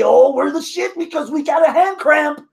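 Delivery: high, smug mocking quote; mocking boast. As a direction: sneer it like a show-off. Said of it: "the one stable that you say".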